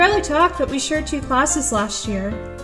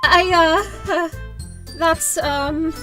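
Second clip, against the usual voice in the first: halting, higher-pitched stammer; flustered, lost for words; 3 semitones higher, slower than usual. Acted. Newcomer meeting the club, tongue-tied stammer. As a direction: grope for words between awkward pauses. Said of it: "all words escape me".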